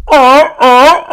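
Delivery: high, loud barking seal call; imitating a seal's loud barking call. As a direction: bark it out like a seal. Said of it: "he would do the seal call".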